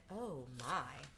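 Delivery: a soft, low little gasp of wonder; Scarecrow voice. Acted. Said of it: "the Scarecrow said".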